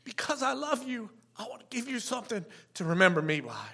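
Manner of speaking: soft, tearful, starting high and sinking; real tears, barely getting the words out; crying. Real, not acted. Dad voicing his kids' reason for the gift, crying. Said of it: "Sorry, I'm crying already".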